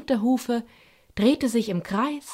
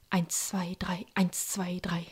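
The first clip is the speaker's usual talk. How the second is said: softly whispered count; quiet counting; 7 dB quieter than usual. Acted. Eukalypto counting his juggling to himself, whispered. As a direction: count under your breath to stay focused. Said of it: "zählte Eukalypto leise".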